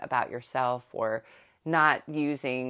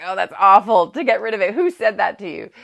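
higher, much louder, appalled scolding parent; shocked parent; 6 semitones higher, 12 dB louder than usual. Retold or imitated. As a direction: play the horrified mom reacting to a kid's new phrase. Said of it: "the worst thing a parent can say".

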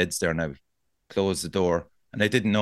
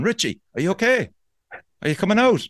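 high, slightly louder call to someone inside; stranger calling; 9 semitones higher, 3 dB louder than usual. Retold or imitated. Stranger at the door asking if he is okay, high call. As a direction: check on him through the door, pitched up. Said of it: "here was the stranger going".

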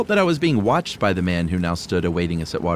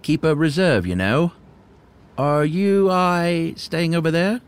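put-on creaky old hermit; 7 semitones higher, slower than usual. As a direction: play the awkward old hermit. Voices the hermit.

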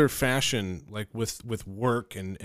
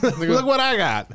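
put-on high, slow, childish brag; squeaky proud kid voice; 11 semitones higher, much slower than usual. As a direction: show it off like a proud little kid. Mock showing badge to security.

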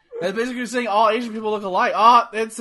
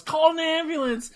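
having a heart attack; 7 semitones higher than usual. Retold or imitated. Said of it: "fakes a heart attack".

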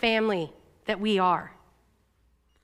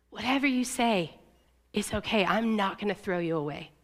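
soft, lowered, gently reassuring voice; tender reassurance; 4 semitones lower, 5 dB quieter than usual. Retold or imitated. He kneels in front of me to reassure me, tender. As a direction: reassure softly, as if kneeling beside someone hurting.

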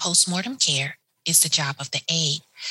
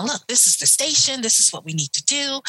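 higher and bright-eyed, showing off her find; proud showing off; 7 semitones higher than usual. Retold or imitated. Aide showing the nurse the radio station, proud and excited. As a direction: present it like a discovery.

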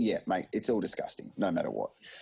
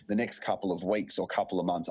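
ordinary delivery

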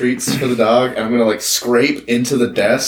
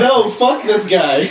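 laughing; 8 semitones higher, slower than usual. Real, not acted. Laughing at absurd dice roll.

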